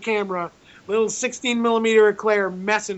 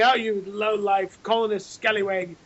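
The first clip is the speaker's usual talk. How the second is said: put-on pathetic British accent; 3 dB quieter than usual. British general scolding a filmmaker, in a poor mock British accent. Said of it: "My pathetic attempt at an accent".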